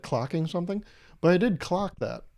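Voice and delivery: ordinary delivery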